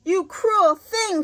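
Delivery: high, slow indignant cry; girl's high outraged cry at the witch; Dorothy crying out. Acted. Dorothy scolding the witch, high slow outcry. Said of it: "cried Dorothy".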